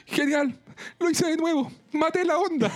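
high, hammed-up tearful whine; mock crying. A narrator's reading. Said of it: "Brita llorando desde".

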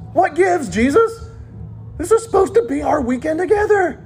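high-pitched indignant disciple complaint; hammed-up, put-out protest; exasperated whining. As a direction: whine it high, feeling cheated. Acted annoyed disciples confronting Jesus.

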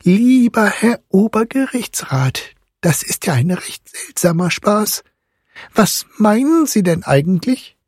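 high, serious mother's voice; earnest reproof. A narrator's reading. Mother questions the godfather's odd joke, high and earnest. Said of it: "Die Mutter blickte sehr ernsthaft und sprach".

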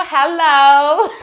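high laughing hello. Real, not acted. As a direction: laugh through the greeting. Laughing greeting.

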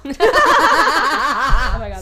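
loud burst of laughter; cracking up mid-sentence; laughing. Real, not acted.

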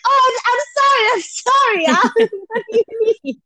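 high-pitched mock apology; hammed-up flustered backing down, breaking into a laugh; tough guy caving. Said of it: "pretend to be this big tough guy. And it's like".

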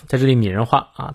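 normal talking voice

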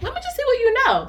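high, quick, sing-out coaxing; light high-pitched retold prompt; mom's quizzing voice. Mom quizzing her child.

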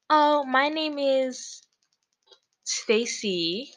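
imitating a friend's high, drawn-out fake voice; weird prank-call voice. Friend prank calling as a made-up Stacy, weird put-on voice. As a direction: put on a silly high voice, stretching the words. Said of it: "She put on, like, this weird voice".

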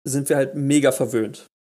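usual speaking voice

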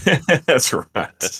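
laughing while answering. Real, not acted. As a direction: chuckle through the words. Laughing at dog antics.

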